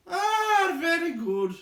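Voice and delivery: high, loud jolly laugh-line; a merry chuckle through the words; jolly high guard voice, pleased. Acted. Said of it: "laughed the chief of the guards".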